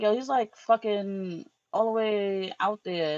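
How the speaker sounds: high, stunned retelling, drawn out at the end; mock astonished exclaim; retold disbelief. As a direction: replay the moment it hit you. Retold shock at realizing distance.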